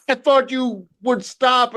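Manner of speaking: high, slow put-on whine; mock pleading mom. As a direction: plead like a fed-up mother. Mother pleads, voiced.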